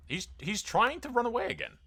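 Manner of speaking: high-pitched disbelief; real astonished protest; incredulous. Real, not acted. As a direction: pitch shoots up, indignant at the escape. Foe dragon flees from him again.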